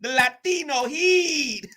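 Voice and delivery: high, slow drawn-out heat; Eddie Guerrero catchphrase. Retold or imitated. Streamer repeats the wrestler's line, slow and high. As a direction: stretch the catchphrase out. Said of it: "quoting Eddie Guerrero".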